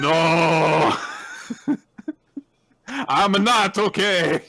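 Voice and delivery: long high comic wail; mock breakdown. Don breaking down among sheet music, hammed-up wail. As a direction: wail it out slowly and melodramatically.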